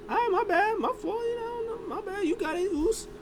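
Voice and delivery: high, soft sheepish sorry; sheepish apology. Retold or imitated. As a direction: back down with a meek high voice.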